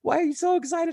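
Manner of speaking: lightly voiced puzzled children, pitched high; high-pitched mock kid voice; imitated kids. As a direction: imitate your kids questioning an overexcited dad. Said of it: "My kids were like".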